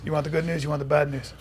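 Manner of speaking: soft, low-key probing question; acting coach impression. Acting coach offers a student good or bad news, quietly. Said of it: "go like this. He would go".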